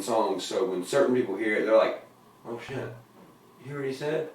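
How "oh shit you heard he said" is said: hushed, amazed whisper. Retold or imitated. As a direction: breathe it like a secret. Listeners' amazed reaction, quoted.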